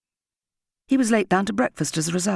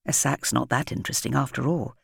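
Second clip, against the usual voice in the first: ordinary delivery, nothing marked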